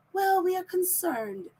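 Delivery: a light, airy send-up of a weak official line; mock concern. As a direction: mildly mimic a limp statement. Mocking officials' weak response.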